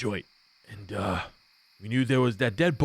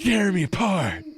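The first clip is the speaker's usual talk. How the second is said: higher, shouted stage anguish; theater kid cry; 8 semitones higher, 3 dB louder than usual. Acted. Thug opens his jacket and wails at the party, higher and shouting. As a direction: fling it out like a melodrama. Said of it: "very theater kid".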